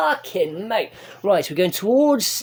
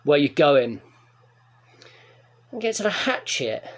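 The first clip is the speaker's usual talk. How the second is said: ordinary delivery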